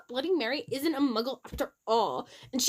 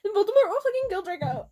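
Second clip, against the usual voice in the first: tearful rant; 9 semitones higher, faster than usual. Acted.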